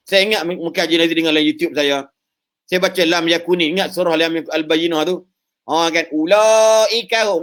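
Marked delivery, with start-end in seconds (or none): 6.1-7.4 drawn-out recitation with Kedah vowels; Kedah accent. Shown as a demonstration. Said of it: "orang Kedah".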